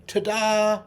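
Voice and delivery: high, loud showman's ta-da; magician's ta-da. Pretend. Hand pointing at the learner, magician's triumphant ta-da. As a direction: pop it out like the big reveal of a trick. Magician figure in memory picture.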